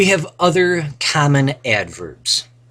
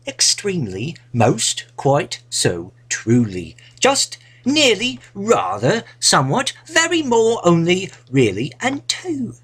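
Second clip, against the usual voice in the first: higher, put-on British accent reading the list; 7 semitones higher than usual. Teacher reads the intensifier list to the class in a mock British accent. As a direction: read each intensifier with a British lilt. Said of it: "In a British accent".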